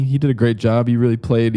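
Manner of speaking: usual speaking voice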